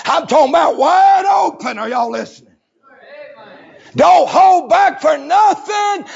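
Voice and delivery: preaching at full force. Acted. Preacher shouting the story to the church. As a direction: whoop it out to the congregation.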